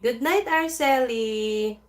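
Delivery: slow loud goodnight; drawn-out goodnight. Real, not acted. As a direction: stretch out the goodnight warmly.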